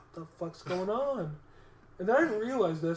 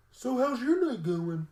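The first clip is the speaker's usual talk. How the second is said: mock cadet voice; 6 semitones higher, 5 dB louder, slower than usual. Mocking the young cadet's small talk.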